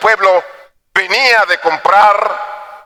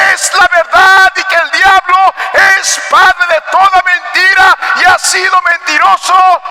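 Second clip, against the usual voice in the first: high, forceful preaching shout; prédica a voz en cuello; 12 semitones higher, 4 dB louder than usual. Real, not acted. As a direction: preach it at full force, pitched high and sustained. Predica a toda fuerza. (predicar a gritos con fervor)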